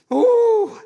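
high, loud whooping ooh; ecstatic ooh. Real, not acted. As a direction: let out a joyful holler. Ecstatic preacher cry.

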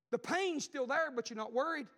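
ordinary talk, nothing marked

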